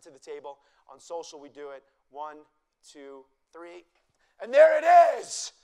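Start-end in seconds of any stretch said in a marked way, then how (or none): 4.4-5.5 high, loud and slow, a showman's big reveal; excited reveal. Real, not acted. Chef unveils the smoke dome to the audience, loud and thrilled. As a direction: unveil it to the crowd with a flourish.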